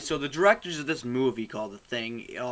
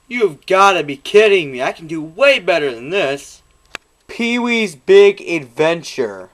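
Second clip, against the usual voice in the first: exasperated; 4 semitones higher, 13 dB louder than usual. Real, not acted. Disgusted at movie choice.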